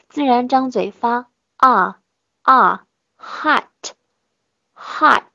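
啊 held long with the mouth still, then hot, hot slowly; a still, open 啊 in hot; slow demo of the pure ah vowel in hot. Shown as a demonstration.

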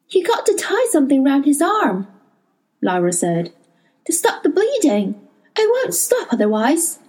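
put-on a girl pressing the old man to act; urgent young girl. A narrator's reading. Lyra voiced in narration.

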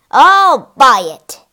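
loud, drawn-out exclamation; eager cry. A narrator's reading. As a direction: cry it out with delight, loud and slow. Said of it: "he cried".

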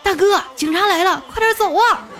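yelling. A narrator's reading. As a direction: bellow the warning across the bank. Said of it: "大声地吼道".

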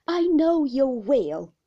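slow, loud affirmation; loud, heartfelt assurance; fond cry. A narrator's reading. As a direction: exclaim it warmly, stretching the words. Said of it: "cried Peggotty".

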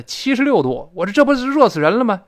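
dramatised astonished outburst; retold alarm. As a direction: exclaim as if shocked at the heat. Recounts reading the thermostat, alarmed.

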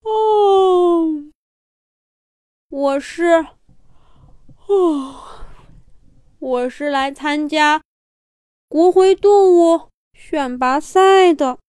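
slow, drawn-out yawny speech; yawning sleepy bear. Acted. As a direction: yawn through the words. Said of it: "就打着哈欠说". (打着哈欠的小熊)

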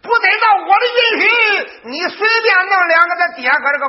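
high, loud, fast scolding yell; furious shout. Acted. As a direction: tear into the old man at full pitch. Son rages at his father. (儿子冲着爹高声怒骂)

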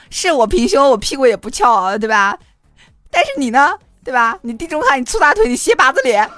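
mock angry retort. Girl's mock tirade of insults.

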